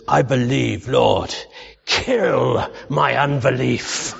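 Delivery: put-on a rough, half-laughing go at a German evangelist accent, very slow; preacher quoting Bonnke's prayer in a rough German accent; attempted Bonnke accent. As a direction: borrow Reinhard Bonnke's German accent and drag out the last line. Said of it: "I wish I could do his accent".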